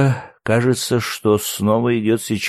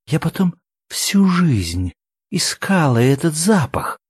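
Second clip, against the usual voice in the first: high, wistful tearful voice; smiling through tears; 8 semitones higher than usual. A narrator's reading. Woman confesses a lifelong search, smiling through tears. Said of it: "улыбнулась сквозь слёзы".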